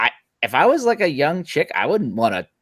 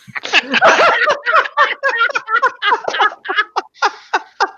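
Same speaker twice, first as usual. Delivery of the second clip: long high-pitched burst of laughter; howling laugh that goes on and on; big laugh; 3 dB louder than usual. Real, not acted. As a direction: crack up at your own punchline. Cracks up at own punchline.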